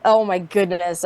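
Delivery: ordinary talk, nothing marked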